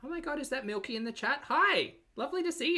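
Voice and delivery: high, delighted hello to a friend; pitched-way-up thrilled hello; excited greeting. Real, not acted. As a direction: squeal a welcome to a familiar face. Greets viewer in chat.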